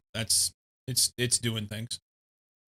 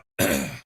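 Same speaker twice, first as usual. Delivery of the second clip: harsh throat clearing; 11 semitones lower than usual. Real, not acted. Choking on crumbs.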